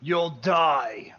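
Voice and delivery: loud, menacing orc shout; shouted threat. Acted. Orc chief answers a one-on-one challenge, shouted threat. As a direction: throw the threat out loudly.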